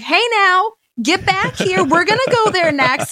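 high, loud calling her back. Retold or imitated. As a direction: call the wanderer back, playful. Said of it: "I was like".

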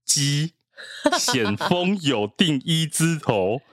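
slow, halting backwards read. Shown as a demonstration. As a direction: sound out the reversed words slowly. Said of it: "倒着念哦".

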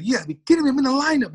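gleeful disbelief at good news; you gotta be kidding me, said elated; excited. Retold or imitated. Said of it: "I'm all excited".

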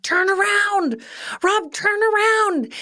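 high, urgent retold yell; re-enacted panicked holler; yelling from the back. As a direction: call out in alarm, pitch way up. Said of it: "I yell from the back".

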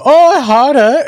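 high, loud cheeky moan-like tease; mock-pleasured tease. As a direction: goad her with a mock-pleased cry. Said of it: "And then I go".

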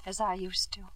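soft whisper, words fading; whispered regret. Acted. Wife admitting lost love to husband, whispered. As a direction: let it fall away to a whisper. Wife's bitter quiet admission.

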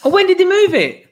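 high, loud, incredulous outburst; can't believe the fixture moved; stunned surprise. Real, not acted. Host learning the match was moved, high loud incredulous.

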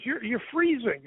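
high-pitched imitation of surprise; retold alarm. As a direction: act out her startled discovery. Retells her shocked reaction.

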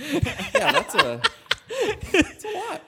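high, loud laughing reaction; words squeezed out through laughter; laughing that's a lot. Real, not acted. Listener laughing at story.

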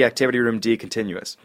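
ordinary talk, nothing marked

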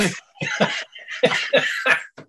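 breathless high giggle; high wheezy laugh. Real, not acted. Laughing at the photographer.

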